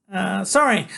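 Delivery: high, loud nervous apology; chattery high. A narrator's reading. Freak refuses Iggy's invitation, chattery high voice. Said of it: "his voice is chatterly high".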